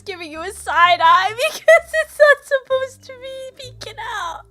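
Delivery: high, breaking laugh-voice; crying with laughter mid-story; laughing hard. Real, not acted. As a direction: talk through tears of laughter. Explains joke while cracking up.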